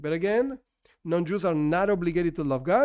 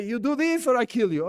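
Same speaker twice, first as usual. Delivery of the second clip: raised-pitch pretend gunman's threat; hammed-up ultimatum, pitched up; mock threat; 5 semitones higher, 4 dB louder than usual. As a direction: play at threatening someone at gunpoint. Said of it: "a weapon in my head and say".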